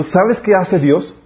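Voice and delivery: ordinary talk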